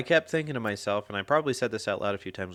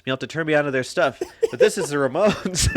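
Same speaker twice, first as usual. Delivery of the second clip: laughing through a quote; 6 semitones higher, 10 dB louder than usual. Retold or imitated. Quoting a line, laughing.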